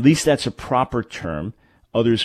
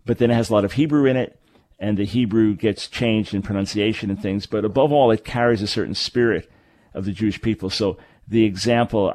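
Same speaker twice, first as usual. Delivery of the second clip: ordinary delivery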